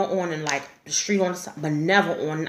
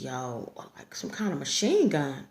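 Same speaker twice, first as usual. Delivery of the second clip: hushed whisper, much softer; 5 semitones lower, 6 dB quieter than usual. Retold or imitated. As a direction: whisper it like a secret. Boyfriend quoted describing gunfire.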